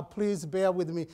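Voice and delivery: normal talking voice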